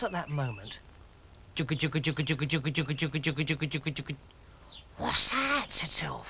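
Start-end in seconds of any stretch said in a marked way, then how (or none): 1.6-4.2 steady rhythmic machine chug; evenly repeated chugging noise; washing machine chugging. A narrator's reading. Narrator imitates washing machine noise. 5.0-5.7 high, curious little frog; lightly voiced curious frog; puzzled frog. Sylv hears a strange noise.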